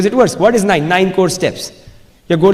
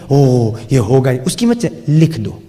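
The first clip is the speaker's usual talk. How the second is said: a hint of a lazy doubter; mock excuse voice; 6 semitones lower than usual. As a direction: lightly mimic someone making excuses. Mocking audience excuse.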